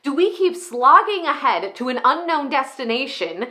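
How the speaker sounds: exasperated comic rant, pitch often jumping high; worked-up comedic monologue, easing off briefly at weary asides; [name], comedic rant. Acted. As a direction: rant like a fed-up, struggling parent with punchy stressed words. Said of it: "this sort of comedic, ranty way".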